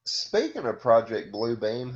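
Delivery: usual speaking voice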